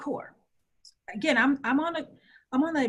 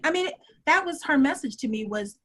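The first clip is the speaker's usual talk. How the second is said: normal talking voice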